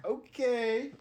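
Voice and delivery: a sharp high okay of disbelief; mock okay. Listener reacting to the detective, surprised okay. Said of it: "I was like".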